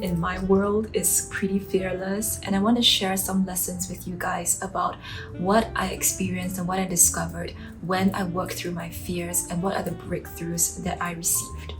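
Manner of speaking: normal talking voice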